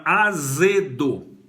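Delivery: azedo drawn out syllable by syllable; slow articulation. Shown as a demonstration. Teacher showing the Z inside azedo, slowly articulated. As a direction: stretch azedo slowly, sounding the Z. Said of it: "a articulação do Z".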